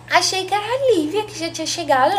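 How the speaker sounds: a touch of high, flustered surprise; surprised mother. Retold or imitated. Mother mistook the visitor for Lívia, mildly surprised.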